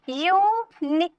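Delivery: "यूनि-" very slow, long यू, then नि, broken off; syllable by syllable. Shown as a demonstration. Teacher starting a syllable count, यूनि- drawn out in two pieces. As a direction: stretch यू and नि apart. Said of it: "यू अलग हो गया".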